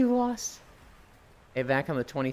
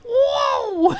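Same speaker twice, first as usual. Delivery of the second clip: very high, loud cartoon yelp; Basil Geas whoa; 10 dB louder than usual. Monster knocked over, a high loud yelp. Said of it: "and he goes".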